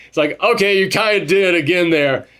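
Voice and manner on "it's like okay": usual speaking voice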